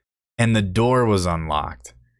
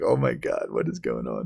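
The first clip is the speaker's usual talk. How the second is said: stunned aside; 10 semitones lower, 6 dB quieter than usual. Retold or imitated. Own stunned inner reaction, retold.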